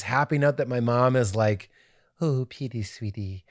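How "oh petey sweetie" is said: soft, low, cooing mother voice; Missus Doubtfire. Retold or imitated. Son imitating his mom praising him after the show, Missus Doubtfire voice. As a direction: dote on your child, slowing and softening to the end. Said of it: "why she Missus Doubtfire".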